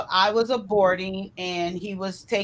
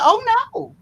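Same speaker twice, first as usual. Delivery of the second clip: a high, loud "oh no" of disbelief; shocked refusal; 13 semitones higher, 6 dB louder than usual. Retold or imitated. Refusing the radiologist's abortion offer, high and loud. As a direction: recoil at the question.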